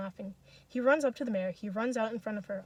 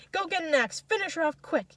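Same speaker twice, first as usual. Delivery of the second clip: higher, urgent spectator call; moderately raised shout; 6 semitones higher, 4 dB louder than usual. A narrator's reading. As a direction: urge them on from the crowd. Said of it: "someone else shouts".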